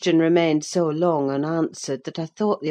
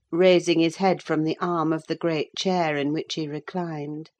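ordinary delivery

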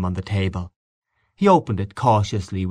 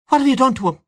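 high, louder furious demand; accusing cry; 4 dB louder, much faster than usual. Acted. Wife confronting her husband over the child, a high angry cry. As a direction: glare and accuse him. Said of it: "she cried".

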